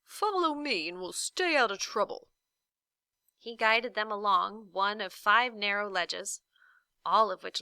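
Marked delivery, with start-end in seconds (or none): 0.0-2.3 pompous Humbug. A narrator's reading. Humbug boasting.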